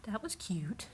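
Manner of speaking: soft whispered aside. Real, not acted. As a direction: whisper it so the cat isn't startled. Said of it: "I hope they heard that".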